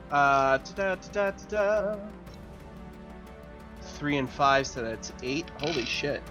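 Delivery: ordinary delivery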